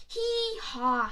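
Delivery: high hee-haw bray; donkey bray. Acted. Narrator voicing the donkey bray, high.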